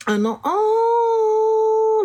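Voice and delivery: long, very high drawn-out wail; panicked wail. Pretend. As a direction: stretch it into a panicked moan. Acted panic attack at work.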